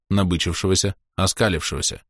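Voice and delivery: usual speaking voice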